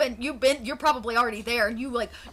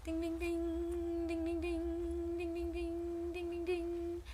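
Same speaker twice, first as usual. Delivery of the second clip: high, soft, sustained dinging; imitated bell ringing; 7 semitones higher, 10 dB quieter, slower than usual. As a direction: ring out evenly like a distant bell. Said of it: "you hear a".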